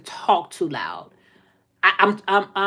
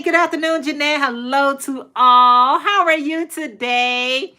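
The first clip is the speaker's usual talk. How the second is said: high, stretched-out sing-song hello; cheery greeting; 5 semitones higher than usual. Real, not acted. Reading viewer's greeting aloud.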